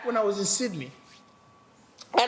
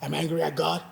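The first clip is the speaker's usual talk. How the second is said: mild retold anger; 6 semitones lower, 5 dB quieter than usual. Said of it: "I was like".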